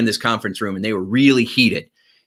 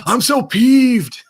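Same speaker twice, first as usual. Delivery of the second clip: high, loud, drawn-out fake outrage amid laughter; mock anger; 13 semitones higher, 6 dB louder, much slower than usual. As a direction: ham up being hugely peeved, slowly. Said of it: "how angry can you get".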